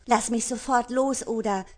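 scolding. Acted. Destiny scolds the pirate gripping her arm, loud and furious. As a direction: snap at him to let go. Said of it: "schimpfte Destiny".

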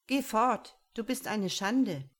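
forceful, scolding dismissal; stern rejection. A narrator's reading. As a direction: banish them in disgust. Parents angrily banish their child.

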